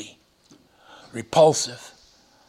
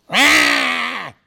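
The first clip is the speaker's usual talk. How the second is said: high, loud retold scream of the demon; preacher acting out the demon's high scream; 9 semitones higher, 4 dB louder than usual. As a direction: let out the demon's great cry, high. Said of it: "screamed with a great voice".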